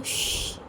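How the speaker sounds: soft unvoiced hush; quiet him with a long shh; shushing. A narrator's reading. Hushing the nervous partner. (धीमी फुसफुसाती श्श्श)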